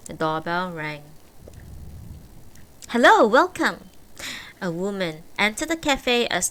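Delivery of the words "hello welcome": loud, high shop welcome; cheerful greeting. A narrator's reading. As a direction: call out a bright welcome. Café owner greets customer.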